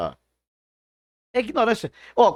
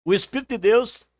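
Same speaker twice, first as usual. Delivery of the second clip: ordinary talk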